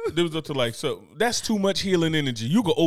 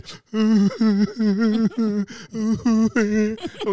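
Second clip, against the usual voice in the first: higher, broken fake sobs; mock crying; 5 semitones higher than usual. Comic showing co-host a long cry, mock sobbing. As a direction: keep a pretend cry going. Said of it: "I would have been over here".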